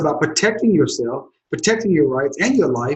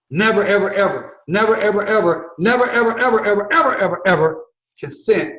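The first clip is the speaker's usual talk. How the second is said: rising, insistent chant of "never, ever"; emphatic repetition; 6 semitones higher than usual. Shown as a demonstration. Lawyer hammering that viewers must never consent, building. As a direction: pile the "ever"s up, higher each time. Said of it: "I'm saying this over and over".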